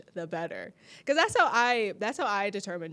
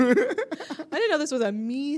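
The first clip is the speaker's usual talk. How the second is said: slow, laughing mock disbelief; 5 semitones higher, 5 dB louder, slower than usual. Playing the unprepared partner, slow laughing disbelief. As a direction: drag it out with a laugh. Mock surprise at being asked.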